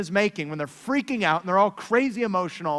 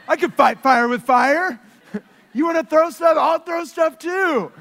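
louder, higher hammed-up tantrum; louder, higher childish taunting; mock childish parent; 7 semitones higher, 7 dB louder than usual. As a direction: play a parent acting like a kid. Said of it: "you start acting like them".

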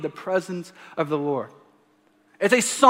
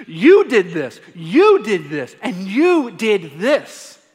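"you" hit each time, slowing down more and more; emphatic repetition; 5 dB louder, slower than usual. Retold or imitated. Pastor listing God's deeds for the congregation, emphatic and slowing. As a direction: lean hard on "you", slower each time.

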